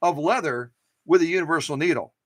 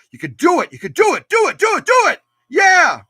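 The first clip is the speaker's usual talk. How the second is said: cheering, louder and higher with every do it; root for the machine like a fan in the stands; a building chant of do it, topped off with a loud yeah; excited cheering; 12 semitones higher, 9 dB louder than usual. Real, not acted.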